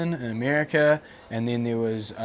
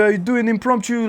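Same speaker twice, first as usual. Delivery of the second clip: French guy's "impromptu" voiced higher, with a far-off timbre; mock French guy; 11 semitones higher, 7 dB louder than usual. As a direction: lightly play the French guy asking. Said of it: "He's like".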